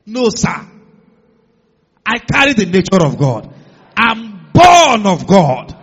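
loud, ringing proclamation, slowing on born of God; triumphant declaration. Real, not acted. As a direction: shout it to the rafters with conviction. Preacher declares triumphantly to congregation.

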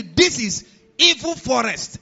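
loud, slow dire warning; ominous warning. Retold or imitated. To the new pastor, loud slow ominous warning about the land. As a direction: boom it out like a dread omen.

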